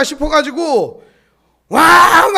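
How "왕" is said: high, loud storytelling with a drawn-out roar; loud imitated engine roar. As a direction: bellow the truck's getaway, stretching the roar. Imitates truck roaring away in story. (트럭 굉음을 크게 흉내 내며)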